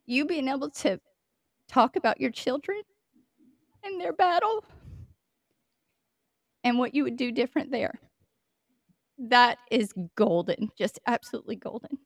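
praising her through tears she tries to hold back; fighting back tears. Real, not acted. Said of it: "I'm gonna try really hard not to get emotional".

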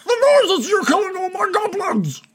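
very high, strained yell; high-pitched outraged goblin boss; exasperated shout. Acted. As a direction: scream the answer back, fed up. Bargle yells back, exasperated.

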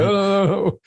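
imitating a shocked parent, pitch jumping high; hammed-up alarmed parent; mock shock. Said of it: "you go into shock and say".

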